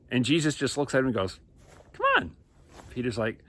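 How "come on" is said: high, sing-song coaxing come on; playful high-pitched nudge. Acted. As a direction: tease him into it with a lilting come on. Jesus coaxing Peter.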